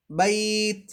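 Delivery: "বাইত" with a long ai, ending on t; sustained recitation-style "বাইত"; one-alif stretch. Shown as a demonstration. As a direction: hold the ai of "বাইত" before the t. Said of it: "একালিফ টেনে পড়বো".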